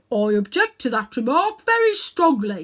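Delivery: loud indignant bark; offended bulldog. Acted. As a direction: bristle at the insult. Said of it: "said the bulldog".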